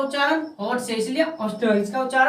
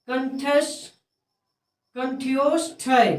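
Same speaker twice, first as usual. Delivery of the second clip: कंठ्योष्ठय drawn out syllable by syllable; slow sounding-out; much slower than usual. Shown as a demonstration. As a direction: sound out कंठ्योष्ठय slowly in pieces. Teacher sounds out a hard term.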